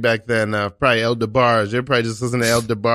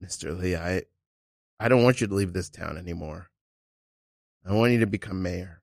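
soft, lower, earnest appeal; heartfelt plea; 5 semitones lower, 7 dB quieter than usual. Acted. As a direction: plead sincerely and quietly with a departing hero. Improv heartfelt plea to stay.

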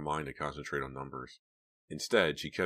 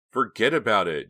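firm; 6 semitones higher, 11 dB louder than usual. A narrator's reading. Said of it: "Jade said firmly".

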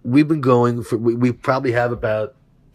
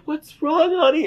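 mock sweet girl; 4 dB louder than usual. Voicing the girl he likes.

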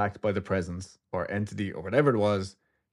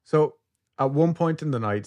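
normal talking voice

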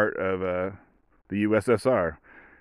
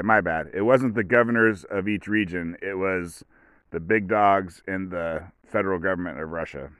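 ordinary delivery

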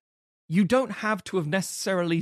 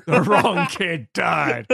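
slow laughing mock grief; 2 semitones higher, 7 dB louder, much slower than usual. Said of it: "the whole literal phrase of".

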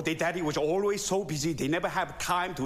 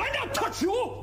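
indignant shout; slower than usual. Acted. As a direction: shout it back, offended. Argument, son says don't touch.

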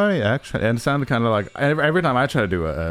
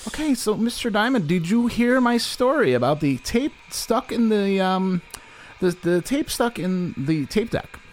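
interviewer voice; 9 semitones higher than usual. Acted. Interviewer voice, high pitch.

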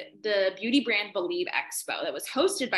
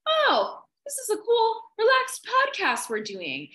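high, breezy imitation of a relaxed girl, slower at the end; relaxed contestant; 12 semitones higher, 4 dB louder, slower than usual. Contestant relaxing for a pretend podcast, imitated high and breezy. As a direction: mimic her suddenly easygoing tone. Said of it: "She was a completely different person".

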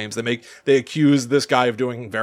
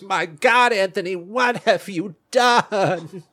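slow, higher, hammed-up gasp of horror; mock horrified wife; 7 semitones higher, slower than usual. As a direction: play the shocked wife, drawn out and over the top. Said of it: "she goes".

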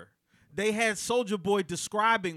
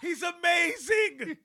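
excited shout; 10 semitones higher, slower than usual. Real, not acted. Host raving about the interview, excited shout. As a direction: shout it out in disbelief. Laughing outburst at the story.

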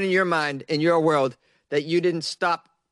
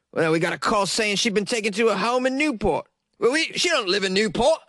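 higher-pitched put-on British accent, quoting the son; 4 semitones higher than usual. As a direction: read the son's quote in a mock British accent. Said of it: "I can't do this accent anymore".

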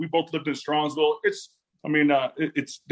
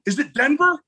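high, louder call from the other room; dad calling from the background; 12 semitones higher, 4 dB louder than usual. Retold or imitated. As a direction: shout the question from the background like an excited dad. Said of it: "my dad's in the background going".

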